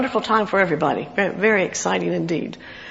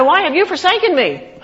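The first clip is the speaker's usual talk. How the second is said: raised, pleading cry to God; 8 semitones higher, 4 dB louder than usual. Acted. Psalmist calls to God his rock, crying out. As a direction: cry it out high and loud. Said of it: "I cry".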